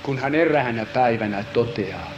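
low, soft, solemn telling; hushed narration. Acted. Preacher telling the prophet's experience, hushed. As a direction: speak it low and grave.

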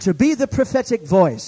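high, loud proclamation; fervent preacher at full voice; full-force preaching. Real, not acted. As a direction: drive it out to the whole congregation.

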